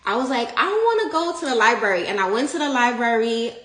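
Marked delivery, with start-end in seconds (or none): 0.6-2.1 quick, high and excited; eager. Retold or imitated. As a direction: blurt it out eagerly. Excited decision to go.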